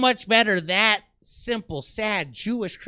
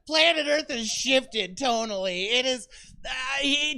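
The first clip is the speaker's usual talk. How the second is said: usual speaking voice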